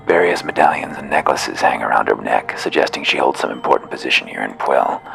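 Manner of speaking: ordinary talk, nothing marked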